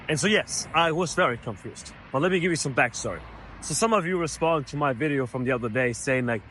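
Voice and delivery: usual speaking voice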